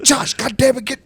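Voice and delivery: mock exasperated telling-off, voice jumping high; pissed-off dad. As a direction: imitate an angry dad cussing out his son. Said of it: "He was pissed".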